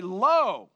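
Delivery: "Lo" loud and drawn out; extreme emphasis. Acted. Preacher showing what Jesus is trying to say, emphatic "Lo". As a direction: throw out "Lo" as a big exclamation. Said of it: "a word of extreme emphasis".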